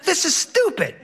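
put-on high know-it-all little boy; high kid voice dismissing the captain; whiny kid. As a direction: scoff like a bored smart-aleck child. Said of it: "Henry says".

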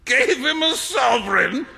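high incredulous shout; shouted in disbelief. Acted. Trevor shouting at Hughie's folly, incredulous shout. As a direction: shout it out, can't believe it. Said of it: "shouted Trevor".

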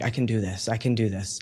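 softly muttered to himself, a mild imitation of the moment; under his breath, talking himself through panic; quiet self-talk. Anxious man at a cafe menu calms himself, quietly. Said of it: "I'm like".

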